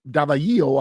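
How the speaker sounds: ordinary talk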